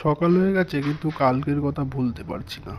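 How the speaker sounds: plain reading voice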